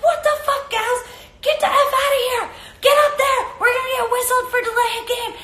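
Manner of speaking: imitated high-pitched shouting at teammates; Alyssa Nair. Host as goalkeeper yelling teammates away, exasperated shout. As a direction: bark frantic orders at the huggers. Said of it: "She's yelling at her teammates".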